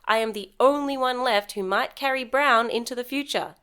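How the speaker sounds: normal talking voice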